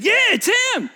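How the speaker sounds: high, loud shout; neighbor insisting. Acted. Neighbor shouting that it's the beggar, high and loud. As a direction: yell it across the crowd.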